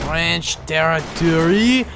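put-on slow, playful French accent. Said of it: "I always do French accent".

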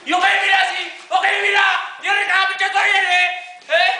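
loud, high angry cop; pretend cop shouting. Kid playing cop yells at the suspect. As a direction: yell like a tough cop.

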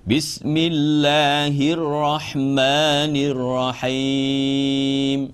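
slow, held-note Arabic recitation; measured tajweed-style recitation; Quran recitation. Shown as a demonstration. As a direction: chant it slowly with long steady vowels. Teacher recites Quran slowly.

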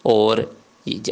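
ordinary talk

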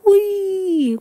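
high, loud gleeful whee; happy whistle cry. Acted. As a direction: let out a friendly whistle.

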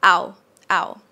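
"Ow" cut short; short vowel. Shown as a demonstration. Teacher shows the short version, brief "Ow". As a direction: say "Ow" quickly, without stretching it. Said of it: "just make it shorter".

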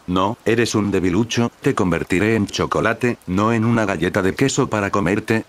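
ordinary delivery

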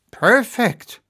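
high, loud exclamation of approval; exclaiming. A narrator's reading. Major approves the powder plan, exclaiming high and loud. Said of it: "exclaimed the major".

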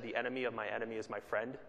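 ordinary delivery

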